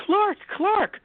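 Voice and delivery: high mock shout of a name; mock Lois calling. Mimicking Lois yelling for Clark, high mock cry. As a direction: squeal the name like Lois in the film.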